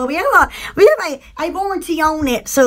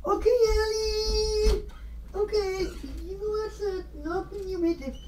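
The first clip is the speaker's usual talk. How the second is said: soft to dog; 4 semitones higher, 12 dB quieter than usual. Real, not acted. Owner coaxing Allie outside, soft and tender. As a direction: coax the dog gently.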